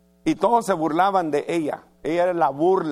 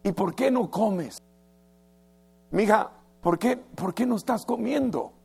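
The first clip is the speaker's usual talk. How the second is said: gentle, concerned questions, a little higher; worried, tender husband; 4 semitones higher than usual. Retold or imitated. Husband Elcana gently asking his crying wife, tender and worried. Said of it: "Vino con una gran preocupación".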